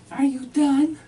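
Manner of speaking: high, loud sarcastic wife voice; mimicking his wife. Husband voices his exasperated wife at the table, high and loud. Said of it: "and she says".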